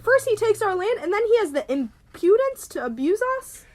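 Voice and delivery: high, loud indignation; outraged. Acted. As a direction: rant in affront about the departed guest. Daughter rages after suitor leaves.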